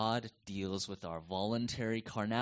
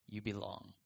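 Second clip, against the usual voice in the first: much softer, near-hushed echo; soft repeat; 4 semitones lower, 10 dB quieter than usual. Shown as a demonstration. Pastor assures doubting believers they belong, softly repeated. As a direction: let it land gently, softer.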